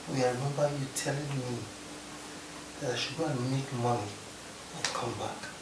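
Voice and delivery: subdued reproach. Acted. Hurt man asks partner to let him sleep, subdued reproach. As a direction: say it softly, wanting to be left alone.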